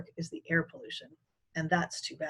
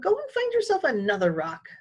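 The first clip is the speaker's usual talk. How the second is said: higher, exasperated shout; exasperated boy shouting, higher; 9 semitones higher than usual. A narrator's reading. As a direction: yell at her to clear off. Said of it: "I shouted".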